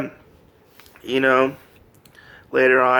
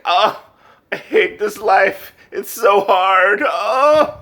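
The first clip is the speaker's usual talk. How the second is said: higher, hammed-up groaning complaint; mock whining; 6 semitones higher, 4 dB louder, slower than usual. Overworked hero lamenting his hard life, mock whining. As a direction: lightly whine it, dragging out the last words.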